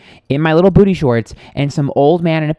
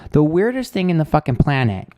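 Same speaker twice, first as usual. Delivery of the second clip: ordinary talk, nothing marked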